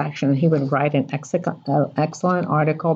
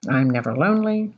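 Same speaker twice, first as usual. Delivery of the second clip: normal talking voice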